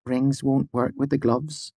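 put-on high, quick girlish voice; light shy young woman; gentle young woman.

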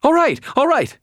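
very high, loud, panicked giving in; squealed frantic surrender; frightened stammer. A narrator's reading. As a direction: yelp it hastily in terror. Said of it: "she stammered".

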